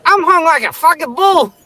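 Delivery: very high squawky parrot; mock parrot voice. The parrot boasting to Cindy, very high squawk. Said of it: "And he's like".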